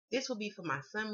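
ordinary delivery

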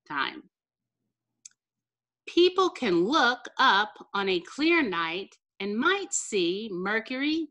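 usual speaking voice

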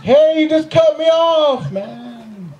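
high, slow, timid mock road rage; feeble road rage. Driver who got cut off, raging feebly. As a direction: play a man too meek to rage at a driver. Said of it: "I can't even get road rage right".

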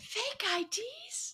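high, slow mock stage whisper. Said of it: "stage whisper".

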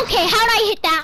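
fast, loud, high disbelief; surprised. Real, not acted. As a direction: blurt it out amazed. Surprised at lucky shot.

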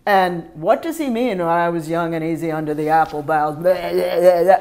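loud, monotone mock recital dissolving into babble; mock droning teacher. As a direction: parody a teacher reciting with no feeling. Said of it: "if they're not just standing there going".

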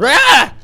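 sudden high, loud yelp; startled yelp. Real, not acted. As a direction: jump at the sight. Startled by weird dentist.

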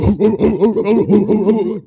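rapid run of loud, high dog barks; loud barking. Acted. Reading an ad that says to start barking, loud barking. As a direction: bark like a big dog, full out. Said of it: "it says for us to start barking".

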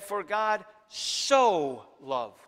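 "so" stretched very slowly in "For God so loved"; "For God so loved" slowed right down, weight on "so"; stress on so. Shown as a demonstration. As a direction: lean hard and slow on the word "so". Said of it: "that little word so indicates the intensity".

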